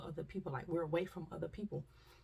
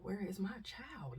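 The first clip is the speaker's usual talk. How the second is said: a hushed, slow, anxious whisper; hushed panicked whisper for a child; whispered worried call; 3 semitones higher, 4 dB quieter, slower than usual. Retold or imitated. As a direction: whisper it like a lost parent. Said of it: "You hear people saying".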